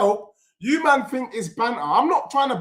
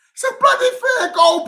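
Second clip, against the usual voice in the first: sudden high shout of protest; real outraged cry; outraged yell; 13 semitones higher, faster than usual. Real, not acted. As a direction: cry out at the injustice. Disallowed goal protest.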